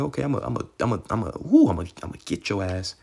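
a hammed-up divine warning; harsh threat from the Lord. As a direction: voice God threatening, half-playful. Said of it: "in a very harsh way".

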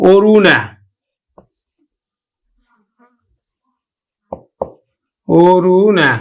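অরুণা carefully, as "o-ru-na"; closed initial o. Shown as a demonstration. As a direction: round the first vowel of অরুণা to o. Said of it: "ও এর মতো উচ্চারণ হবে". (অরুণা-কে "ওরুনা" উচ্চারণ)

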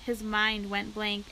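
plain reading voice

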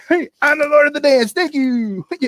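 high, loud, fast showman boast; boastful dog. Acted. The dancing dog brags to the crowd, high, loud and fast. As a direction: brag like a proud performer.

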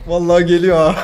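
loud panicky laugh through words. Real, not acted. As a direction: laugh while the threat comes. Enemy approaching, laughing. (gülerek telaşla)

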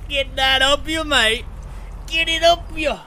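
put-on high, loud Aussie bloke accent; Australian accent. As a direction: bellow it like a rowdy Aussie. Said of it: "full-grown Aussie".

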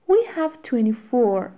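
quick, natural-speed answer; natural fast speed. Shown as a demonstration. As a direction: say it briskly at real speed. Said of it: "fast drill repeat".

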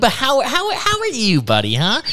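high-pitched swooping needling of an interrupter; mock-solicitous. Real, not acted. Host to the friend who interrupted him, mock-solicitous. Mockingly hands over the floor.

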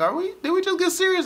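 high, fast mock disbelief. Vlogger imitating [name] teasing [name], high mock surprise. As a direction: toss it off like a startled diva. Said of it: "[name]'s like".

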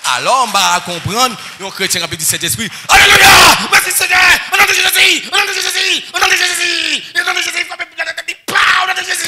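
loud, high sung preaching over music; chanted full-force sermon. Real, not acted. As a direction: preach it out with fire. Preaching climax shouted.

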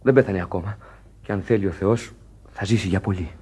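low, soft worried murmur; quiet concern. Acted. Sailors murmuring low and worried at the sickbed. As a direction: keep your voice down, worried.

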